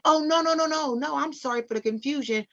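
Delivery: high, rushed oh no no no; hurriedly clearing up the mix-up; flustered correction. Retold or imitated. Caller explains the name mix-up, flustered and high.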